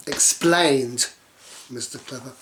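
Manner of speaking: ordinary delivery, nothing marked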